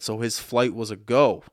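normal talking voice

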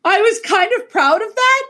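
a little laugh of pride; half-laughing boast; proud. Real, not acted. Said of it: "but also mortified".

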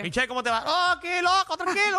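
put-on high, playful Dominican accent. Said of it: "si estuvieras hablando con acento dominicano full". (acento dominicano exagerado)

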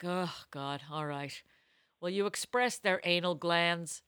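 soft, low grudging request; resigned, softly retold question; reluctant asking. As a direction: ask it quietly, with reluctance.

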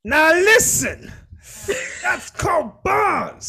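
drawn out, high, excited shout-out; hyped announcement. Acted. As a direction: announce it like a hype man. Hyping up a rap line.

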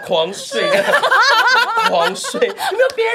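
high laughing cry for someone else; laughing mock plea. Friend mocks the dreamer begging someone else to chase her, laughing. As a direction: whine through laughter.

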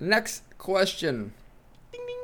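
slow, drawn-out host announcement; mock game-show host. Host segment transition.

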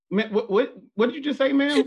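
high, startled what did you say; mock-baffled store clerk asking again; shocked disbelief. Store clerk stunned by the mom's phrase, high startled disbelief. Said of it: "she was like".

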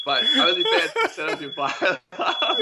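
laughing through the words, high; giggling in disbelief. Real, not acted. Laughing at the fire alarm.

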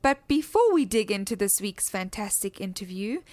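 usual speaking voice

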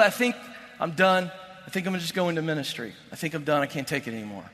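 imitating a crushed player ready to quit; defeated and done. Player confides in his brother, softly and defeated. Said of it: "He tells his brother".

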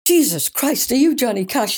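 high astonished gasp of a question; shocked realisation. Retold or imitated. To [name] on the phone, high stunned realisation.

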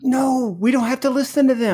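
a lightly voiced kid asking his grown self what happened; eight-year-old self. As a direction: plead like the boy who wanted baseball. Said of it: "This is eight-year-old you".

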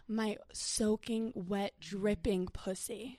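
slow, soft and lingering; hushed bedroom read, very slow; sultry phone sex voice. Shown as a demonstration. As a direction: draw every word out, seductively. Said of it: "you say, like".

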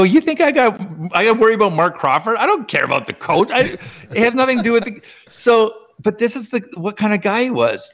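high, heated protest; mock outraged reply; indignant retort. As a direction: fire back in disbelief. Retold heated reply to Brian.

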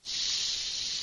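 long soft audible exhale, letting the breath go; deep breath out. Shown as a demonstration. Teacher demonstrating a calming deep breath for the class, long soft exhale. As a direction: breathe out slowly and deeply to show the body it is safe. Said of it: "taking a really good deep breath".

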